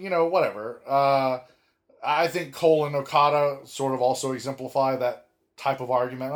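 normal talking voice

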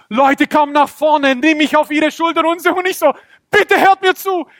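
very high, excited shouting; urgent protest. Acted. Dreamer carried on shoulders giving God credit, high urgent protest. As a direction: plead to be heard over cheers.

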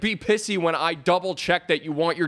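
high-pitched exasperated tirade, easing down at the end; pissed-off rant. Real, not acted. Server venting about the dessert complaint, pissed off. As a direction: vent indignantly at an absent customer. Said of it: "It just pissed me off so much".

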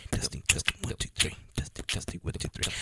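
breathless, whispered last counts; out of breath, voice running out. Real, not acted. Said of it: "I'm out of breath, guys".

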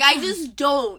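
loud, curt brush-off; blunt dismissal. Retold or imitated. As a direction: shut it down flatly and loudly. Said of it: "You're like".

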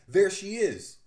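loud, high shout; loud high yell of discovery; orderly screaming. Acted. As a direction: yell it across the hallway. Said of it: "one of them screams".

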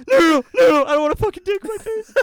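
very high, loud, fast screaming protest; a scared teen bolts upright yelling at the drunk stranger, panicked. Retold or imitated. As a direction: shriek it out like you're terrified. Sits up panicking, retold.

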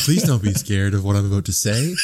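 gentle phone voice for a startled horse. Shown as a demonstration. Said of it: "like talking to a startled horse".

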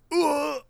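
high, short imitated "uh" grunt. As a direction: mimic the clip's grunt. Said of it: "the who sounds like just an extension of the initial".

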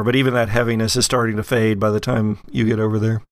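normal talking voice

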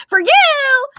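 very high, loud catchphrase; viral audio impression. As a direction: blurt it out high in the imitated voice. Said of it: "I'll just instinctually be like".

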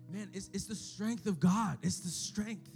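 ordinary talk

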